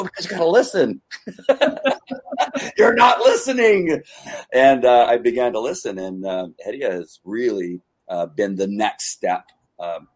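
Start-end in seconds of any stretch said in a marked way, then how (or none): 0.0-4.0 laughing quote. Retold or imitated. Quoting friend, laughing.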